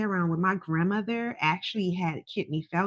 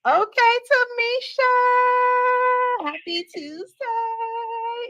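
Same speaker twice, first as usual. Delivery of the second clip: excited greeting; 6 dB louder, much slower than usual. Acted. Greets the caller sing-song.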